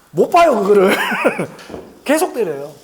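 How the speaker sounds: loud, amused burst breaking into laughter; laughing. Real, not acted. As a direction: laugh through the words, loudly. Laughs telling the head-hitting anecdote.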